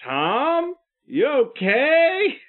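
high, drawn-out rising question; tentative concern. Acted. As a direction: check cautiously on a friend who's acting strangely. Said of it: "I'm like".

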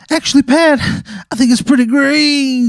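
very high, smooth sultry voice; high silky doctor voice. Acted. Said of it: "why does he talk like that".

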